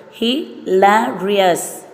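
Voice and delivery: "hilarious" split into four slow beats; slow syllable by syllable. Shown as a demonstration. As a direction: drag out "hilarious" beat by beat. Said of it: "four syllable".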